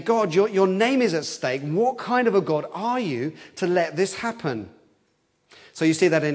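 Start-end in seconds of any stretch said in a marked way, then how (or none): 0.0-4.7 raised, protesting cry; crying out to God. Acted. Old Testament voices crying out to God, protesting. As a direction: demand an answer from God. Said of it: "crying out, saying".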